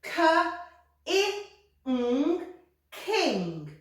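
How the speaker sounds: K, I, ng then king falling; blending. Shown as a demonstration. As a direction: sound out then join into king. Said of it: "Let's read it".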